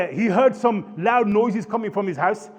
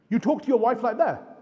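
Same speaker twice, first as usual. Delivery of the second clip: ordinary talk, nothing marked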